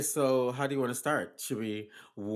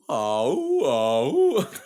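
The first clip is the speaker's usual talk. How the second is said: laughing; 7 semitones higher, 10 dB louder than usual. Acted. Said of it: "Now I'm ready for my cool down".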